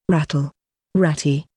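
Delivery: ordinary talk, nothing marked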